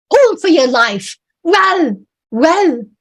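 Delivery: high, loud yells; desperate shout. Acted. Sailor driving the rowers away from the wreck, desperate shout. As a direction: yell for their lives.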